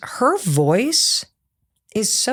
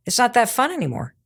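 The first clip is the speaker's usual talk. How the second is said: normal talking voice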